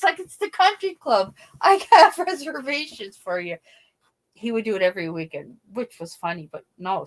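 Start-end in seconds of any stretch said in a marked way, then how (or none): 0.2-3.6 high, loud mock announcement; imitated jokester calling out; loud teasing reassurance. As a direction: sell the joke loudly, pitched up. Cousin's joke answer to grandmothers.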